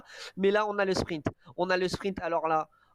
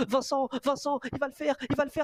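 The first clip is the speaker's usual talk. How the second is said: excited; 8 semitones higher than usual. Real, not acted. Calling the finish.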